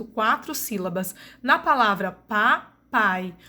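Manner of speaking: ordinary talk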